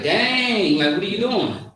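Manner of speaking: "Dang" stretched out long and swooping high; drawn-out slang. Retold or imitated. Teacher imitating slang speakers, "Dang" drawn out high. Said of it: "they expand the word".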